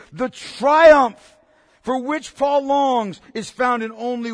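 loud, slowing sermon build; fiery preaching. Real, not acted. Pastor exhorting his congregation, full-force preaching. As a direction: drive the point home to the church.

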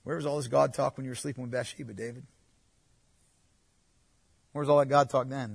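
quiet, quick sneering inner voice; cynical thought. Retold or imitated. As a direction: scoff at him under your breath. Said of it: "we would think to ourselves".

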